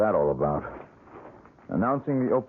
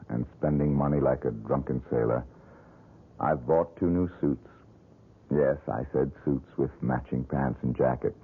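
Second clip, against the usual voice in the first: lower, reflective letter voice-over; a hint of a man writing home, thoughtful; letter read aloud; 7 semitones lower than usual. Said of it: "He sent me just one letter".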